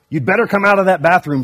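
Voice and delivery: hammed-up angry cub yell; shouted. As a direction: bang on the door and yell at her. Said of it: "shouted Brother".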